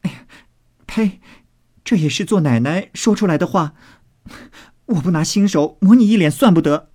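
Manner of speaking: high, scandalised maid's outburst; mock indignation. A narrator's reading. Maid threatening to smear her teasing mistress, mock indignant. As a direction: threaten playfully, pitched well up.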